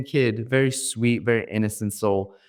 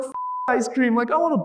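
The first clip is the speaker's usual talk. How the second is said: much higher, exasperated mock; 12 semitones higher, faster than usual. Speaker to friend, exasperated mockery. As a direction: throw his words back at him.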